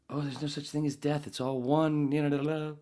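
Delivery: чуть выше, лёгкий тон прозрения; изобрази лёгкое озарение; lightly mock enlightened. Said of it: "you realize".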